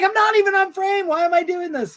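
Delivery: very high mock whine; whiny actress. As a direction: squeal the actress's complaint. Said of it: "she's like".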